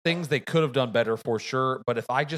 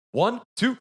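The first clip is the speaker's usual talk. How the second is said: doctor counting; 12 semitones higher, 6 dB louder than usual. Retold or imitated. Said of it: "He goes".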